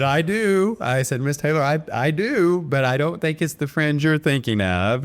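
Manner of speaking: high, excited, knowing reply; an eager, knowing punchline; excited retort. Retold or imitated. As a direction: pitch it up with delight. Excited retelling of his reply.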